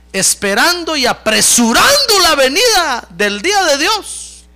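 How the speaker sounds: high, loud ringing proclamation; forceful scripture reading. Acted. Preacher reads verse on hastening Christ's coming, forceful proclamation. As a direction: declaim the verse with full force.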